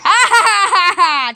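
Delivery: high staccato ha-ha; triumphant laugh. Real, not acted. Gamer gloating after a big hit, triumphant. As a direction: laugh it out in victory.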